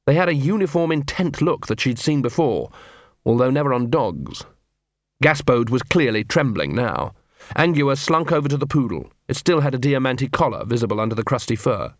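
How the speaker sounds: plain reading voice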